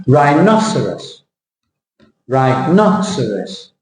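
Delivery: rhinoceros slowly, NOS lifted and stretched; stress on NOS. Shown as a demonstration. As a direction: lean on NOS in rhinoceros, drop -eros. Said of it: "Where is the stress?".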